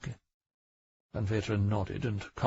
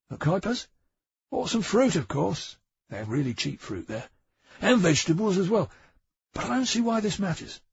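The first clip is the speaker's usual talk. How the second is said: lightly voiced defensive Bang; 10 semitones higher, 4 dB louder than usual. Bang defensive, shifty character voice.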